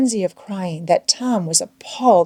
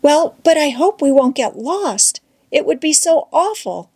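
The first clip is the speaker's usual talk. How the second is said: higher, fearful Becky; Becky with a mild tremor of worry, pitched up; 8 semitones higher than usual. A narrator's reading. Becky fears getting lost.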